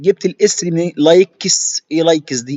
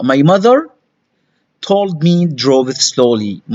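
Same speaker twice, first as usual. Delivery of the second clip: slow model reading; much slower than usual. Shown as a demonstration. Teacher reading the sentence slowly aloud. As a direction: read slowly, word by word. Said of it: "بالراحه".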